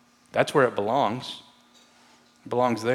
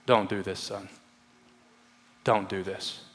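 quiet father's plea; 6 semitones lower, 9 dB quieter than usual. Retold or imitated. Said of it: "a father just talking to his son".

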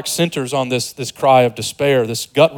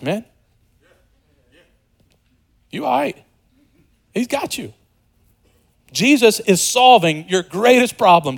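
high, ringing preaching push; impassioned preaching; 7 semitones higher than usual. Acted. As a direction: lift it up and urge the congregation on. Preacher lifts into fervent exhortation.

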